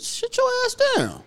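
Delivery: mock high yelled command at a drunk; a put-on squeaky order to sit down; pretend telling off. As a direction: bark it up high like scolding a drunk.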